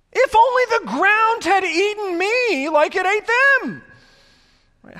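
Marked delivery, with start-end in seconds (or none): 0.1-3.8 high, loud overwrought wail; mock tragic lament from the grumbling people; melodramatic whine. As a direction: ham up the wish to have died. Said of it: "How melodramatic is that?".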